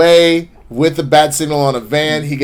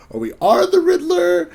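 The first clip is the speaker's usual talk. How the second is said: puzzled question sliding up into a squeak; confused henchman. Acted. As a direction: voice climbing high in confusion. Confused henchman asks for clarification.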